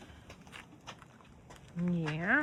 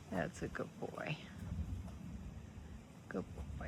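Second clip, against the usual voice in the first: whispered praise; 13 dB quieter than usual. Real, not acted. Owner whispering praise to her horse while he eats.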